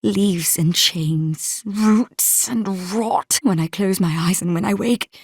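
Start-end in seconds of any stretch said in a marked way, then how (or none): 0.0-3.4 very slow, dread-laden words; haunted slow listing. Acted. Haunted figure naming the visions, slowly, with dread. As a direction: draw out each horror.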